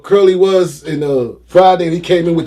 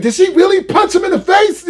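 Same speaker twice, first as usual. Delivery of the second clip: high-pitched disbelief, voice shooting up; incredulous outburst. Retold or imitated. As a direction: exclaim it like a shocked onlooker. Retold reaction to shocking video.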